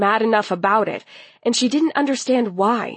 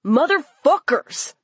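higher, exasperated curse; loud outburst; 7 semitones higher than usual. A narrator's reading. Boy topping his friends' cursing, exasperated outburst. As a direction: burst out with the oath. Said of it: "Vincent somehow responded the loudest".

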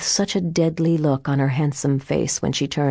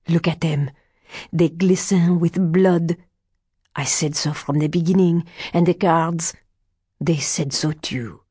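put-on accented old seer, excited then ominous; old fortune teller, insistent. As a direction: warn like a village card reader. Old card reader's accented speech.

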